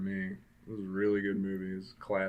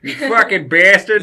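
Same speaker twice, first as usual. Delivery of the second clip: loud, high mock Boston yell; hammed-up Boston accent, much louder; fake Boston accent; 10 semitones higher, 16 dB louder than usual. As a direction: ham up a Boston curse, shouting. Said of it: "fake Boston slang".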